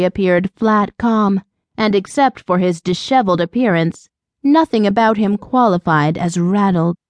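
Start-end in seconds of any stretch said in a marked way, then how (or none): none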